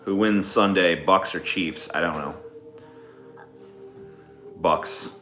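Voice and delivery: ordinary talk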